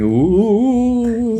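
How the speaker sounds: high, eerie ghostly wail. Retold or imitated. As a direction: moan it like a phantom. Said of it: "ghosts going".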